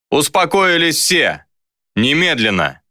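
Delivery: restrained bark of authority; barked order. A narrator's reading. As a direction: order the room to settle down. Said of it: "рявкнул Лука".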